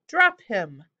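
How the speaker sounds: loud, firm yelled command. A narrator's reading. Simon commanding the griffin to release the hobgoblin, yelling. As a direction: shout the order, falling at the end. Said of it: "Simon yelled".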